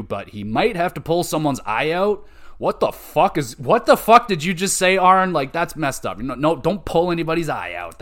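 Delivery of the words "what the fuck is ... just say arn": shocked outburst. Real, not acted. Outraged at Arn's remark.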